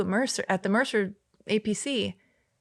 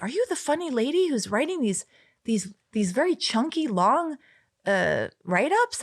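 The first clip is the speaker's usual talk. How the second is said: a lightly voiced French boss, higher; boss from headquarters; 6 semitones higher than usual. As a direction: quote the French boss asking, light imitation. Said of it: "this French guy, and he's like".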